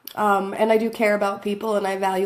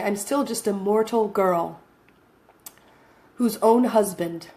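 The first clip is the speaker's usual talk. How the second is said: usual speaking voice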